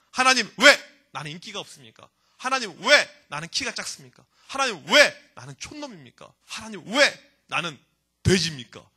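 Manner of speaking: loud drawn-out WHY, then the grievance; complaining. Acted. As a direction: protest to God, hammering each 'why'. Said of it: "그래서 불평합니다".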